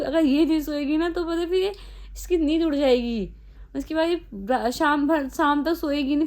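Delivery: ordinary talk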